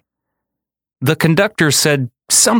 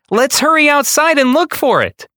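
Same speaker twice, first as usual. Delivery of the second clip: put-on high, urgent child's voice; a child pleading to go look now; child begging the others to search, high and eager. A narrator's reading. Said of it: "one of the children said".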